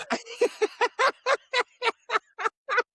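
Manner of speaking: long high-pitched burst of laughter. Real, not acted. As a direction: break into helpless laughter. Laugh after punchline.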